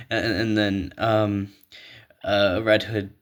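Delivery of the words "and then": normal talking voice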